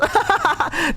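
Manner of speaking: high, quick burst of laughter; imitated genuine laugh. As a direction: imitate her delighted laugh. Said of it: "like, a genuine laugh".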